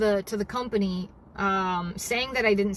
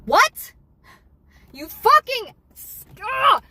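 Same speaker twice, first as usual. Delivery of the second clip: loud, higher, sputtering rage; furious outburst; 10 semitones higher, 8 dB louder, slower than usual. Real, not acted. Driver hearing the hotel's threat, furious outburst. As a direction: explode in disbelief, words failing.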